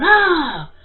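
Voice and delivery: high, loud frightened cry; mother imitating her son's scared yell; child's startled yell. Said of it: "he yelled".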